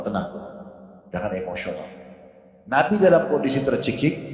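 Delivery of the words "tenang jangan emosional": softer, soothing restraint; quietly calm a hothead. Retold or imitated. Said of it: "dengan tenang memberikan isyarat". (menenangkan dengan lembut)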